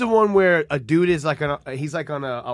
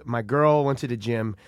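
lightly voiced friend; 5 semitones lower, 4 dB quieter, slower than usual. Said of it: "He goes".

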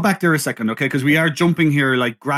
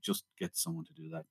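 very soft, low confidential aside; off-camera aside; 10 semitones lower, 21 dB quieter than usual. Acted. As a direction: drop right down as if speaking off camera. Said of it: "off camera kind of went".

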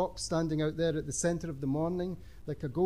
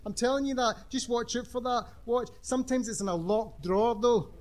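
put-on high eager girl voice; gabbling schoolgirl, lightly voiced; excited teenage girl; 6 semitones higher than usual. Lisa chimes in excitedly.

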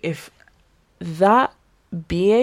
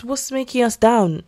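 normal talking voice

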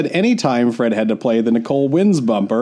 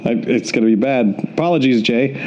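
usual speaking voice

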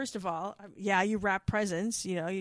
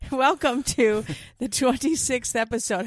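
loud show-opening announcement; announcer voice; 3 semitones higher, 7 dB louder than usual. Acted. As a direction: boom it like a host intro. Podcast intro announcement.

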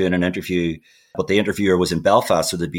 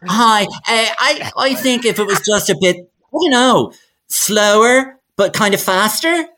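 high, put-on client voice; mock fussy producer; 11 semitones higher than usual. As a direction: play a clueless client giving vague notes. Mock producer voice in booth skit.

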